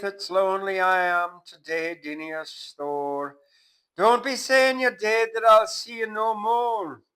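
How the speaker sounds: high, loud keening widow Kitty; crying widow. Acted. Widow Kitty crying for dead Dinny, high hammed-up wail. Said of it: "she cried".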